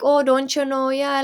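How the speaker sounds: high, sing-out Minnesota nice voice; Minnesota accent. Acted. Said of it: "Minnesota nice thing".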